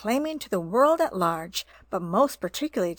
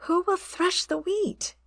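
high hopeful clucking question; hopeful hen call; 10 semitones higher than usual. A narrator's reading. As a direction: ask with hope in your voice. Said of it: "in a very hopeful tone".

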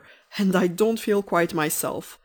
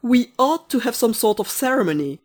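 higher, louder fussing; anxious gentleman; 4 semitones higher, 7 dB louder than usual. Acted. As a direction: worry aloud about proper ceremony. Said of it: "said the nicest gentleman anxiously".

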